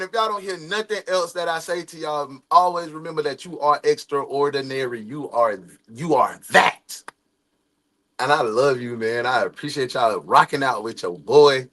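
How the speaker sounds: low, soft and heartfelt; sincere sign-off. Real, not acted. Streamer thanking viewers softly and sincerely. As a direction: speak it gently like a blessing.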